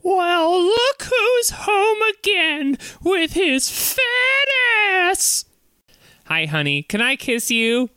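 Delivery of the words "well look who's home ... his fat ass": high, slow, mocking wife greeting; sarcastic wife voice. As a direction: sneer the welcome back at him.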